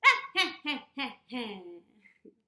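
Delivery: loud cackling witch laugh; loud witch cackle. Shown as a demonstration. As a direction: cackle like an old hag. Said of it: "Let's do it one more time together".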